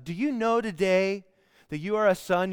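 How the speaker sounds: ordinary talk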